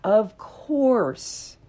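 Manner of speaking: a touch of warm reassurance, slowed; understated reassuring. Pretend. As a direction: reassure them it makes sense. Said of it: "would I be like".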